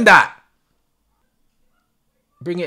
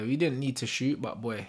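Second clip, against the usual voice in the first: normal talking voice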